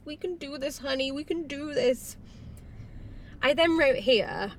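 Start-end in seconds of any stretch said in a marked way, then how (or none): 0.0-2.2 mock tender reassurance. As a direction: hold her and soothe her, lightly. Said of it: "Tyler's like".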